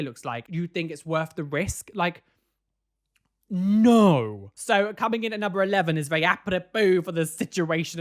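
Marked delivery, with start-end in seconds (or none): 3.5-4.5 emphatic no. Real, not acted. Answering her own question.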